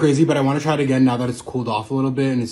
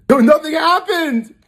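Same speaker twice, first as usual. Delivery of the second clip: high, drawn-out pained cry; panicked shout; 13 semitones higher, 5 dB louder, slower than usual. Real, not acted. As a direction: yell as the burn hits. Spice hits suddenly.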